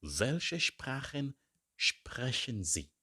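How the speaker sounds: Welche pitched high, the whole question said slowly; slow teacher drill; slow model phrase. Shown as a demonstration.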